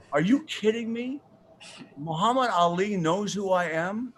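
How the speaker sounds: retold astonishment, pitched high; can't believe your luck; amazed disbelief. Retells amazement at being recognized.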